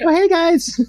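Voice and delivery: high-pitched little greeting; imitating a small high voice; playful Nina voice. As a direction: chirp a high, tiny hello. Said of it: "Nina will be like".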